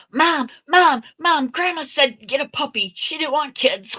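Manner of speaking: put-on high, loud excited tattling child; tattling granddaughter. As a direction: run to Mom and tell on Grandma. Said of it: "My granddaughter's gonna watch this and go".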